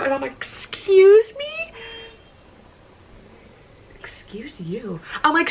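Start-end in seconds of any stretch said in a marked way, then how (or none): 0.3-1.7 very high, loud, stretched mock shock; incredulous outburst. Hearing he was watching her video, incredulous outburst. 4.0-5.0 very soft, slow murmur; whispered aside. Real, not acted. Vlogger breaks off after her outburst, whispered aside.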